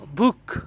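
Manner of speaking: बुक in one loud unbroken beat. Shown as a demonstration. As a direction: punch out बुक as a single syllable. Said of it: "यहाँ पर आपकी आवाज़ कब कहीं भी नहीं टूटी". (बुक एक ही झटके में, बिना तोड़े)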